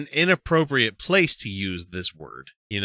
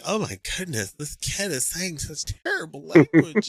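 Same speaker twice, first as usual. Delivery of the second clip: pearl-clutching; 5 semitones higher than usual. Retold or imitated. Imitating shocked townsfolk hearing a kid swear, mock scandalized. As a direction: put on a prim shocked bystander. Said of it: "clutching at their pearls, going".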